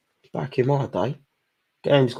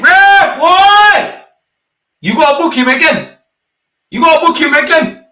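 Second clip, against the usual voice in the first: much higher and louder, outraged demand; angry yell; 13 semitones higher, 9 dB louder than usual. Real, not acted. Fan screaming at the referee to book the player, furious yell. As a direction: bellow at the ref to book him.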